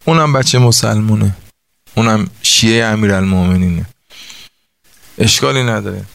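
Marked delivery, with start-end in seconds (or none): none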